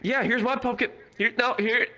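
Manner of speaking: high-pitched eager pleading, imitating a friend; poor friend. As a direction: mimic a friend trying hard to show something off. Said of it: "my fr-, poor friend Gaz is like".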